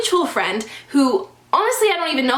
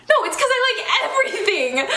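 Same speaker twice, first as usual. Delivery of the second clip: exasperated laugh; 8 semitones higher, faster than usual. Real, not acted. Rebutting his dig, exasperated.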